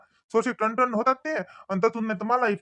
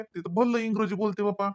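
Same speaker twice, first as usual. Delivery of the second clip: ordinary delivery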